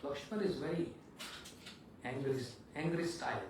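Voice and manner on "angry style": ordinary talk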